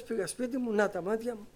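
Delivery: normal talking voice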